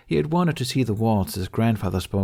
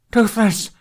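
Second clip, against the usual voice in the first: high, loud cry of alarm; desperate cry; 12 semitones higher, 7 dB louder than usual. A narrator's reading. Hiccup falling in the net, crying out in panic. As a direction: call out for him in terror. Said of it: "he gasped".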